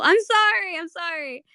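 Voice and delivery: high teary sorry then fading; choked up; emotional apology. Real, not acted. Streamer choked up by the scene, teary apology. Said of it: "making me emotional".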